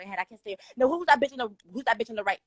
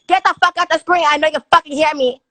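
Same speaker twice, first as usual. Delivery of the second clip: loud, raised demand; angry yell; 5 semitones higher, 13 dB louder than usual. Real, not acted. Telling a guest on the live to get out, an angry yell. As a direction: bark at him to leave the screen.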